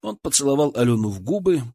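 ordinary delivery

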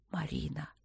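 low-energy, barely voiced call; ghostly whisper. A narrator's reading. Absent Luk calling Marina through the wind, faint whisper. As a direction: breathe her name like an exhalation. Luk's voice heard as breath.

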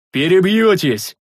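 thunderous refusal. A narrator's reading. Big man turning down sentries, thunderous shout. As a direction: boom out a refusal. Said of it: "громогласно повторил здоровяк".

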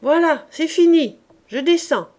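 loud, high call; a roofer calling down cheerfully to his wife. Acted. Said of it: "Il cria à Gervaise".

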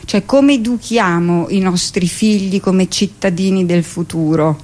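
usual speaking voice

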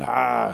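loud drawn-out snarfing "ah"; imitated gobbling noise. Storyteller showing bat piling up food, loud gobbling noise. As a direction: mimic greedy scooping with a loud noise.

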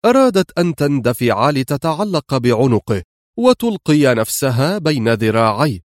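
ordinary talk, nothing marked

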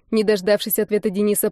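plain reading voice

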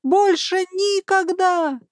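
high, slow panicked skeleton wail; desperate wail. Acted. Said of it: "продолжал вопить".

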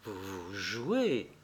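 very soft, low and slow; murmur. Acted. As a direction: falter in a hushed, disbelieving murmur. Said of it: "murmura-t-elle". (murmure hésitant, incrédule)

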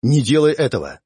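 usual speaking voice

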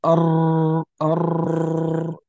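long rolled R in "أر"; trilled ra. Shown as a demonstration. Teacher shows the tongue tapping repeatedly, long trilled ra. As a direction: hold "أر" on a buzzing tongue-tip trill. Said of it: "يعني يمس أكثر من مرة".

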